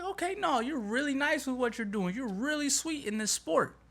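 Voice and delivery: high mock-sweet put-down of a rival; mock patronizing praise. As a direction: pat the rival on the head, sickly sweet.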